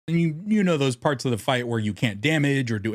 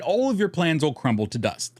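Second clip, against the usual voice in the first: ordinary delivery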